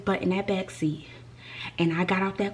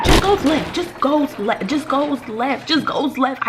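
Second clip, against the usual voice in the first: exasperated; 5 semitones higher, 4 dB louder, slower than usual. Real, not acted. Exasperated retelling of driver's turn.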